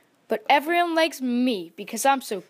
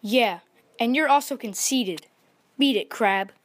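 mildly annoyed character voice; testy dismissal with a touch of snarl; 2 semitones higher, 3 dB louder than usual. A narrator's reading. Chameleon sending the conceited crab away, snarling. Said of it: "snarled the cool chameleon".

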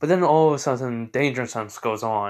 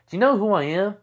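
indignant; 8 semitones higher, 4 dB louder, faster than usual. Acted. Esdeath offended, boasting.

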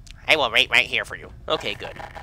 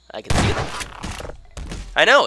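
ordinary talk

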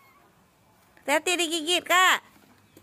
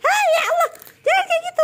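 alarmed shout; 5 dB louder, faster than usual. Real, not acted. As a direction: shout out in alarm as the kitten is squeezed. Parent cries out at child.

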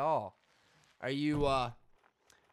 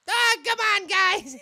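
loud, very high squeaky whine; squealed protest; 8 dB louder than usual. Pretend. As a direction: cry out in a shrieking falsetto. Squealed complaint after voice talk.

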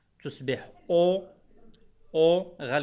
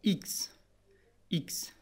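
إكس whispered, letter name x with no voice; 2 semitones higher, 4 dB quieter than usual. Shown as a demonstration. Teacher whispering the letter x to the students. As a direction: breathe out إكس with no voice at all. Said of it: "لا لتر إكس".

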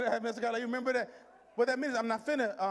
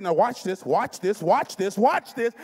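emphatic shout, louder each repeat; building shout; 12 dB louder, slower than usual. Acted. God telling the doubter to look, building shout. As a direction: punch each repeat harder, building to a peak.

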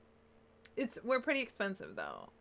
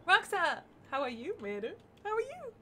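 ordinary talk

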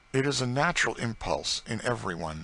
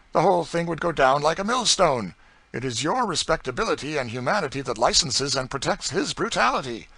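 put-on higher, indignant young man; earnest young gentleman; 8 semitones higher, 8 dB louder than usual. Young gentleman accusing the planter of complicity, indignant. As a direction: press the moral point hard.

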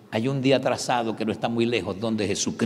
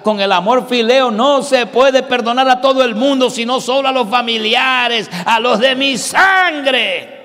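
loud, high, slow shouted sermon; full-force preaching; 7 semitones higher, 10 dB louder, slower than usual. Acted. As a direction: thunder it from the pulpit, climbing higher.

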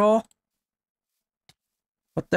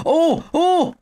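high, loud cries of surprise; excited yelps; 11 semitones higher, 4 dB louder than usual. Real, not acted. Watching the fight turn dirty, high excited yelps. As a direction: yelp at the brutal hit.

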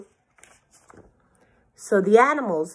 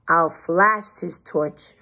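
ordinary delivery, nothing marked

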